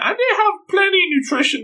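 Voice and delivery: very high squeaky cracked voice; a sudden high squeal mid-quote; squeaky mock co-worker. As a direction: squeak it out like a silly cartoon co-worker.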